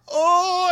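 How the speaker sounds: high mock cry of pain; drawn-out "oh" of someone hit by an arrow; hammed-up dying wail; imitated wounded groan. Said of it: "gets the arrow in his stomach".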